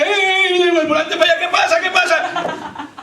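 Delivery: high, comic backseat-driver squawking; panicking wife. Acted. Wife correcting husband at the wheel, mock panic.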